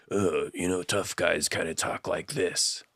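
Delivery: quiet, low mumbled tough-guy voice, imitating; mock gravelly tough guy. Mocking modern movie leading men, a quiet mock tough-guy mumble. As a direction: mumble it like a brooding action hero, mocking. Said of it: "talk in a very gravelly, quiet voice".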